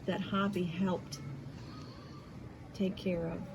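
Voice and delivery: ordinary talk, nothing marked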